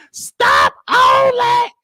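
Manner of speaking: slow, very high shouted command; drawn-out high yell at grandma. Retold or imitated. Yelling at grandma driving.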